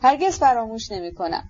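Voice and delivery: ordinary delivery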